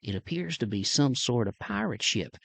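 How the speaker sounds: put-on understated Southern reporter accent. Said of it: "that Southern accent".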